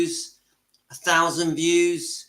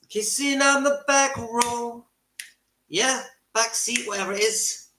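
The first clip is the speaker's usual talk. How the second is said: teasing sing-song; 6 semitones higher than usual. Real, not acted. Teasing about parents kissing.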